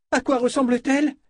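high, frantic question; panicked cry. A narrator's reading. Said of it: "cria Fitch, affolé comme un chat".

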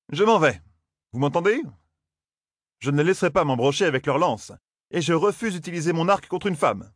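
high voiced young man, exasperated; exasperated Matt. A narrator's reading. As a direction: protest that they're only women. Matt refusing. (Matt exaspéré, voix plus aiguë)

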